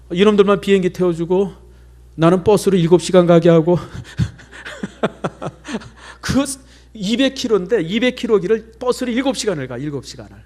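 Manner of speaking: normal talking voice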